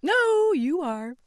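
very high, teasing shout; calling back playfully; playful Piggy. Acted. Playing tag while running off.

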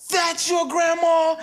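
high, slow, disbelieving yell; surprised kids shouting. Retold or imitated. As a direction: shout it like amazed schoolkids. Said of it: "They'd go".

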